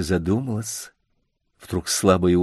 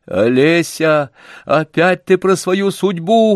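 louder, impatient outburst; impatient exclamation; 6 semitones higher, 5 dB louder, slower than usual. A narrator's reading. Man interrupts Olesya impatiently, louder exclamation. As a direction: cut her off, fed up with fate talk. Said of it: "воскликнул я нетерпеливо".

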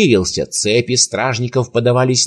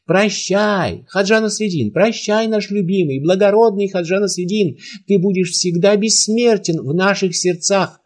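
tearful farewell; 7 semitones higher than usual. A narrator's reading. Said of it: "Многие в толпе плакали, кричали ему вслед".

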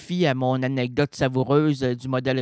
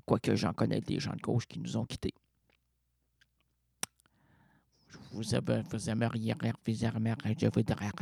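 grave hush; 7 semitones lower, 7 dB quieter than usual. Acted. Comedian lowering his voice for a dark joke about suicides, hushed and grave. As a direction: drop into a hushed funeral tone.

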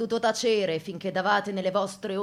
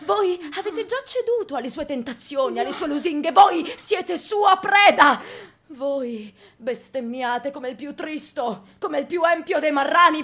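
loud and higher, slowing as it builds; angry denunciation; 6 semitones higher, 12 dB louder than usual. Acted. Priest condemning the young man, angry loud denunciation. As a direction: hurl every accusation.

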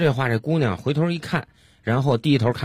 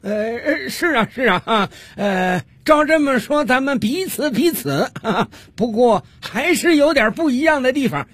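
frowning call; 13 semitones higher, slower than usual. Acted. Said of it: "皱了皱眉头，向这边喊道".

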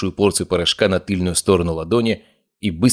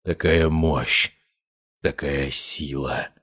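slow, lower, resonant and awed; deep resonant voice; 4 semitones lower, much slower than usual. A narrator's reading. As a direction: let the voice sink deep and ring. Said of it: "Его голос изменился, стал более глубоким, резонирующим".

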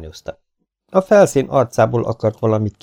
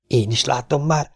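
excited; 6 semitones higher than usual. A narrator's reading. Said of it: "mondta izgatottan".

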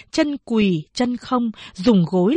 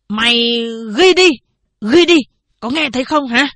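angry order; 3 semitones higher, 5 dB louder than usual. Acted. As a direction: shout the order at the clerk. Old man orders clerk to write. (quát to, gắt gỏng)

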